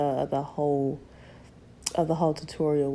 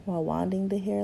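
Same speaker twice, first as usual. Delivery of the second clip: usual speaking voice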